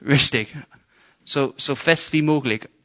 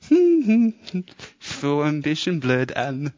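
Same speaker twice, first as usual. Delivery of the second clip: awkward Moses; 2 semitones higher than usual. Pretend. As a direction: feel silly at the sea. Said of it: "ich sag mal".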